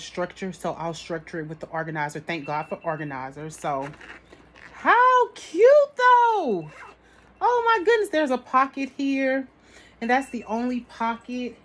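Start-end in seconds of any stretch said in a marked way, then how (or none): 4.7-8.1 excited. Real, not acted. Showing viewers the bag, she coos higher and louder. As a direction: coo over it with delight.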